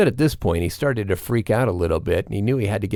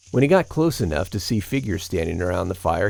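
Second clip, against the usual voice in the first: plain reading voice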